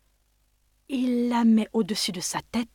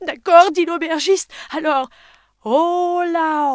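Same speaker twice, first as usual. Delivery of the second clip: desperate shout; 9 semitones higher, 10 dB louder than usual. Acted. Innkeeper agrees desperately.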